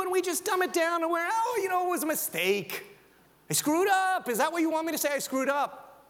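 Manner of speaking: high whiny dismissive voice; mock excuse-maker. Preacher mocking people who dodge sin, whiny. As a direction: brush it off like a sulky teen.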